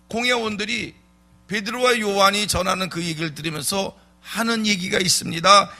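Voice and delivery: ordinary delivery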